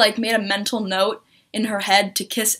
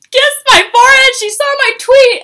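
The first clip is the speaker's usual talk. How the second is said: high, loud squealed shout; overwhelmed excitement; 12 dB louder than usual. Retold or imitated. Fan tells friend what happened, excited high shout. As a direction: re-enact a fan shrieking the news.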